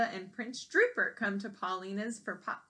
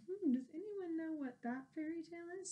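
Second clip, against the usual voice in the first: plain reading voice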